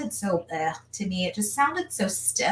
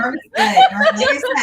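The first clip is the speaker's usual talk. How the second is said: delighted squeal; 8 dB louder, faster than usual. Real, not acted. Delighted about guest.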